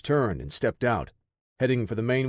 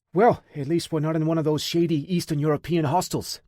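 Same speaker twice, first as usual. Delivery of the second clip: forced jokey bravado, pitched higher; feigned bravery; 5 semitones higher than usual. Acted. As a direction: joke lightly to hide fear. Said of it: "feigning bravery".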